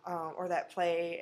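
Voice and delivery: ordinary delivery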